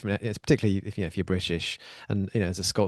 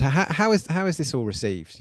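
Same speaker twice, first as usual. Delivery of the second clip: ordinary delivery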